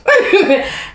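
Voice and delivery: a short, high, amused laugh; real light laughter. Real, not acted. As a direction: laugh at the memory. Laughing after the near-fall story.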